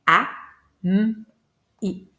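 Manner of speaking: "A, M, I" as three separate sounds with clear pauses; segmented into phonemes. Shown as a demonstration. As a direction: sound out "A, M, I", M hummed as mmm. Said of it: "je vais entendre trois sons".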